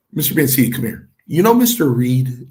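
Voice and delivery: imitating Chief, loud and high; Chief's manner. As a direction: call him over like a booming boss. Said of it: "That's the way Chief was".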